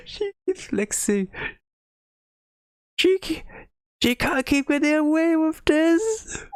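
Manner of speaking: high, slow, mock-indignant squeal; high mock outrage. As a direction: whine in fake outrage. Mock outrage at rich Nagisa.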